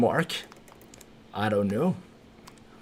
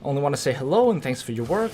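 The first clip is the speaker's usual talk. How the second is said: ordinary talk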